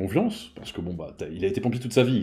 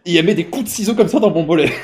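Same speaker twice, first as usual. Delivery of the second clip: laughing; 7 semitones higher, 8 dB louder than usual. Real, not acted. Telling the absurd scissors moment.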